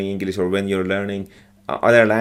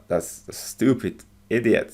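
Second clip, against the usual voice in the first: normal talking voice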